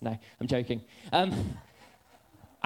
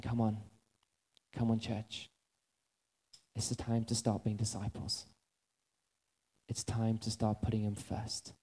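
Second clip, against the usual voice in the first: low, soft, reflective preaching; hushed closing appeal, lower and softer; quiet altar call; 5 semitones lower, 4 dB quieter than usual. Acted. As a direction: draw them inward gently.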